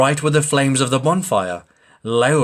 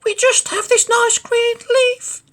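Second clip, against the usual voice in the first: very high squeaky ant voices; much higher piping ant voices; tiny ants; 4 dB louder than usual. Acted.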